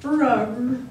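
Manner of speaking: a short rumbling growl; bear growl. Acted. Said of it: "Old Bear growled".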